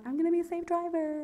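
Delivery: high, softly lilting self-mockery; goody-goody. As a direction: play the virtuous careful driver in a high voice. Mimics own smug careful thought.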